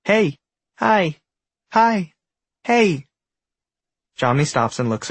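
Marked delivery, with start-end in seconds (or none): 0.0-3.1 high, loud little shouts; tiny shouting. A narrator's reading. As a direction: call up from the ground, much higher. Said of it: "faint shouting from the ground".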